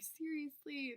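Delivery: mock-incredulous friend; incredulous seriously. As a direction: throw it back in disbelief. Friend reacting in disbelief.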